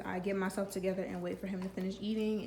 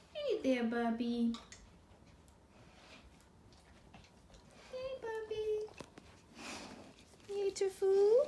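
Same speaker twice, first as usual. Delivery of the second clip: talking to a dog; 10 semitones higher, slower than usual. Real, not acted. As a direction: coo at the puppy. Greeting dog after it ate.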